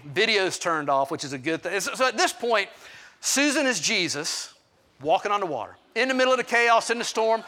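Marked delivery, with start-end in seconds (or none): none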